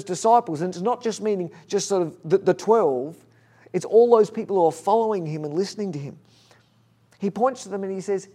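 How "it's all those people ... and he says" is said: ordinary talk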